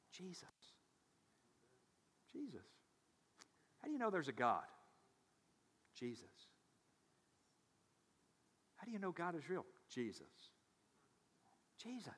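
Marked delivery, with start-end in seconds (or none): 0.1-2.6 very soft, almost breathed; hushed reverence. Real, not acted. Pastor answering how we know eternity is real, hushed. 6.0-6.3 very soft, almost breathed; hushed reverence. Real, not acted. Pastor answering how we know eternity is real, hushed. 9.9-12.1 very soft, almost breathed; hushed reverence. Real, not acted. Pastor answering how we know eternity is real, hushed.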